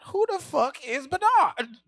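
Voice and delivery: slow, high, loud disbelief; baffled retort. Retold or imitated. Comic at the door, slow loud baffled. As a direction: drag it out, baffled.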